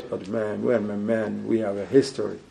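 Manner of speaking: low, quiet mumbling buddy voice; mock street buddy. Preacher mimics street friend, low mumbled buddy voice. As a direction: mutter it like a street friend defending his boy. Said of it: "They're like".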